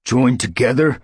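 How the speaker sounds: defiant. A narrator's reading. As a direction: throw the words back in scorn. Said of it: "A defiant mew".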